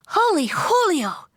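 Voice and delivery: high, slow, squealing kitten voice. A narrator's reading. Kitten seeing chihuahuas in the mirror, high squeal. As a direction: squeal it out in delight, drawn out. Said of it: "squealed the kitty boy".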